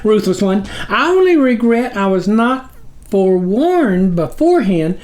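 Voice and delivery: high squeaky flattery; lightly voiced small figure, high and eager; groveling tiny creature. A narrator's reading. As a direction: fawn nervously over the sublord. Snuffer flatters the sublord.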